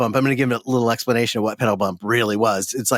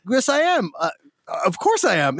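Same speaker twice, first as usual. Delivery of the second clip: indignant lawyer; 6 semitones higher than usual. Retold or imitated. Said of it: "he's like".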